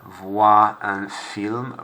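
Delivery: Voir un film drawn out, voir as vwa; very slow French model. Shown as a demonstration. Teacher repeats phrase for class, slow careful French. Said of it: "Not oi, but voir".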